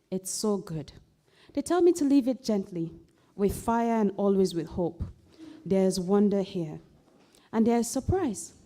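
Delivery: poem recital. Acted. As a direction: read it as a solemn elegy that swells. Poem reading.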